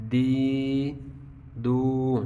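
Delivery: ordinary talk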